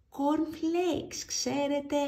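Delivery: ordinary delivery, nothing marked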